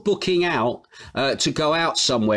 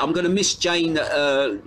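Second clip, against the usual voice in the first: usual speaking voice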